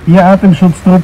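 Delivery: ordinary talk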